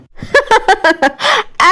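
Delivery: high crazed cackle; mad laugh. A narrator's reading. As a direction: laugh wildly, out of control. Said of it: "وهي بتضحك بجنون".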